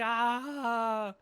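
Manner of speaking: put-on loud, high wail of terrified kids; mock crying scream. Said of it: "they're just crying their tears out".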